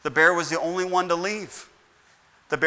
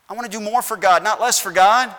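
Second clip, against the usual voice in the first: raised voice; 5 semitones higher, 7 dB louder than usual. Acted. Preacher voicing a believer's resolve.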